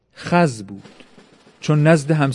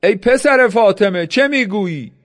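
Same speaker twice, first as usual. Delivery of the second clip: higher-pitched storyteller's mild shout; shouting back; 7 semitones higher than usual. A narrator's reading. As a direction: call out a challenge to the enemy. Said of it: "شمر فریاد زد".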